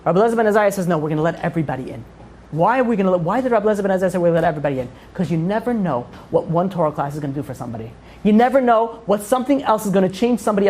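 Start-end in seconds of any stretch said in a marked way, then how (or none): none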